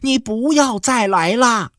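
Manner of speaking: high shouted plea, pitched well up; shouting after him. Acted. Wife shouting at the departing man's back. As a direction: call out sharply at his back. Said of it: "对着老杨的后背喊".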